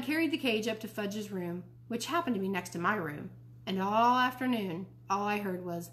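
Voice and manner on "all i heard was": ordinary delivery, nothing marked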